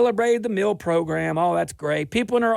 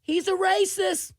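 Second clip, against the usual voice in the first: mock accuser; 9 semitones higher than usual. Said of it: "Go ahead and call me a racist".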